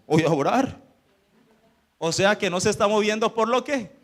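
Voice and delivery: ordinary delivery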